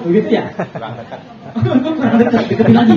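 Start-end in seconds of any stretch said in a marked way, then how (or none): 1.5-3.0 sarcastic parent. Retold or imitated. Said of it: "biasanya kita ngomongnya gimana?".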